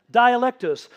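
Dialectos called out loud and high; loud reveal. Real, not acted. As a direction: announce Dialectos to the room, loud. Preacher reveals key word.

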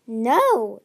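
firm refusal. A narrator's reading. Tsunami refuses flatly.

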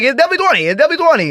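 high, insistent retold objection; insistent protest. Customer arguing the dance price, high insistent protest. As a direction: pitch it up and insist on it.

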